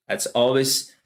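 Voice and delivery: ordinary talk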